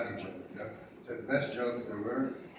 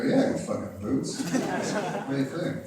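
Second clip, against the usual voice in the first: defiant; 4 semitones higher, 6 dB louder, slower than usual. Retold or imitated. Retorts to boss defiantly.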